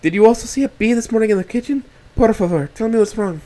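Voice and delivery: high, loud worried woman; pleading woman. A narrator's reading. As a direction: plead with concern. Rosa pleading.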